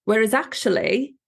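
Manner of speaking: normal talking voice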